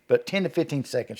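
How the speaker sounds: ordinary delivery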